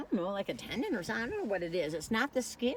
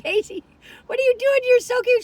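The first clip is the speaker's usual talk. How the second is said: much higher and louder, gushing; delighted cooing; 11 dB louder than usual. Retold or imitated. Retelling gushing over her dog, high and loud. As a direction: squeal it like baby talk to a cute dog.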